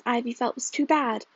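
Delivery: plain reading voice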